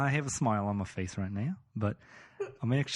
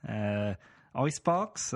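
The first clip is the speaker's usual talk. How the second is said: put-on Aussie apartment inspector; Australian accent. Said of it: "let's get back into character here".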